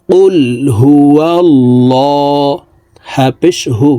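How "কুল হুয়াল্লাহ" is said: কুল হুয়াল্লাহ with a thick, heavy ল, drawn out slowly; heavy lam. Shown as a demonstration. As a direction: pronounce the ল of হুয়াল্লাহ full and heavy. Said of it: "আমরা ল বলে পড়ব". (মোটা ল দিয়ে ধীরে টেনে কুল হুয়াল্লাহ)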